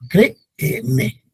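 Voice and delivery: "Créeme" said slowly, cre-e-me, both e's apart; teacher separating the double vowel; slow syllables. Shown as a demonstration. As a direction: stretch "Créeme" over three syllables. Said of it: "todas esas son hiatos".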